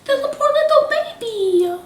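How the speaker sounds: high cooing voice for a tiny creature. Real, not acted. Player coos over the injured tiny sprite, high baby talk.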